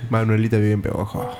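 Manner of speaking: low, soft sensual voice; sultry, hushed low tone. Real, not acted. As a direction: say it low and softly, seductively. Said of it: "¿por qué esa voz sensual?".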